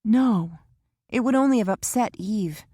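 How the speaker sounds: Kara anxious in the waiting room; young Kara voiced. A narrator's reading. Kara's voice in the novel.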